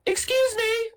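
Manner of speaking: very high, sweet lady voice; squeaky mock female voice; flirty woman.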